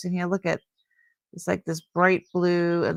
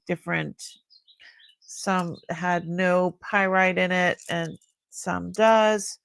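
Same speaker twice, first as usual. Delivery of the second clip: ordinary delivery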